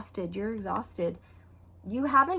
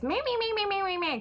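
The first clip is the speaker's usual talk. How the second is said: high bleating meh meh much higher; whiny Muppet bleating at a nagging spouse; Beaker from the Muppets; 11 semitones higher than usual. Retold or imitated. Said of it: "complain like Beaker off of the Muppets".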